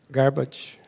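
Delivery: normal talking voice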